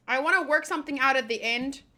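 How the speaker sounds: ordinary talk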